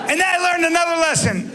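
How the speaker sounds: high, loud announcement; loud and high declaration; shouted. Acted. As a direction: shout it to the room. Stand-up bit, punchline build.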